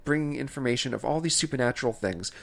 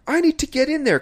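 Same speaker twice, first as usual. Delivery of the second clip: much higher, louder mock-urgent voice; urgent woman; 13 semitones higher, 6 dB louder than usual. Retelling the woman at the sealed-off room, mock urgency. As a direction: imitate a frantic movie heroine, pitched way up. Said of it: "the woman is like".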